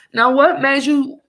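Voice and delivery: high, pointed accusing question; indignant confrontation. Retold or imitated. Mother confronting the absent father, indignant. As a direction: demand an answer from him.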